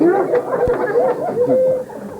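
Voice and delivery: gleeful high cackle; evil laugh. Acted. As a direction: cackle with delight at the prank. Devil laughs at prank.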